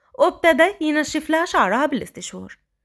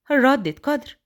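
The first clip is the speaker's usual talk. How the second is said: ordinary delivery, nothing marked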